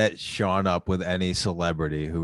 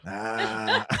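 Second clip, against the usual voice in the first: raspy [name] voice; slow, raspy seductive drawl; smoker's rasp; much slower than usual. Pretend. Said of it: "that's how you think [name] sounds like a chain smoker".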